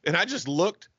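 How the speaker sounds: normal talking voice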